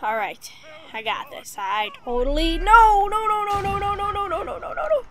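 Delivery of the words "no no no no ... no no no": high, loud no's tumbling out; panicked no. Real, not acted. Gamer in trouble in game, high panicked no's. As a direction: panic as things go wrong.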